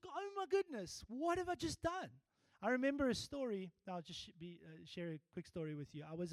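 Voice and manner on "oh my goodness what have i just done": mock horror. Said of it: "regret enters you".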